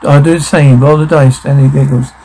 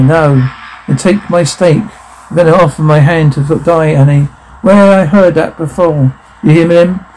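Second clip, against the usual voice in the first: ordinary talk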